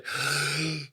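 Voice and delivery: sharp gasping breath in; hyperventilating gasp. Shown as a demonstration. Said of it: "you have to really hyperventilate".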